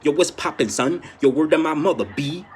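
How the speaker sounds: loud mock New York greeting; mocking New York slang. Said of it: "Stop saying that every New Yorker".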